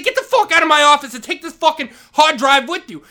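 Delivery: furious yell. Retold or imitated. Vice principal ordering janitor out, furious yell. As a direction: throw him out shouting. Retold shouting match with principal.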